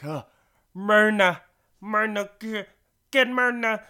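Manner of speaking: high, loud put-on character voice; imitated plea for Myrna. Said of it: "He, he's like".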